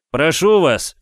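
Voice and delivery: high, loud plea from outside; begging through the door; pleading stranger. A narrator's reading. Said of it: "пробормотали снаружи". (мольба снаружи)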